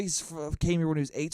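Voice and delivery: ordinary talk, nothing marked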